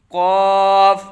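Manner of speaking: loud, sustained قاف with a back q; full, thick Qaaf. Shown as a demonstration. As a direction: round and thicken قاف as it stretches. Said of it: "یعنی موٹا کر کے پڑھیں گے".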